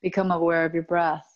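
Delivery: calm, even yoga nidra voice with long pauses; steady, low-key meditation guide, phrase by phrase; guided meditation. Acted. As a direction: lead them inward, unhurried, letting each phrase rest.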